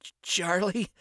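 weak, broken last words; dying gasp. Acted. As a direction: struggle to speak while dying. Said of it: "he managed before the light".

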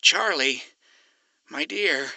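soft, gentle consoling; tender. Acted. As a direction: comfort her softly. Alistair comforting Charlie.

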